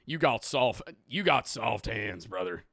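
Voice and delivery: put-on slow country accent. Shown as a demonstration. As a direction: do a country-boy accent, drawing out the words. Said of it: "Can I not do a country accent?".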